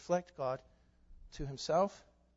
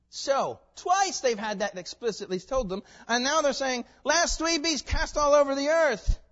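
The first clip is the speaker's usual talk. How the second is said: rising, louder preaching; preaching at full force; 11 semitones higher, 8 dB louder than usual. Real, not acted. Preacher to congregation, rising to a forceful pitch. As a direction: build the point with fervor.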